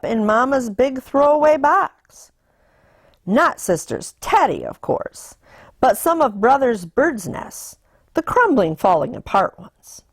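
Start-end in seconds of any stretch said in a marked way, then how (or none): none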